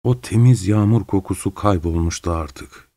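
plain reading voice

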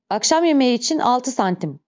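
ordinary delivery, nothing marked

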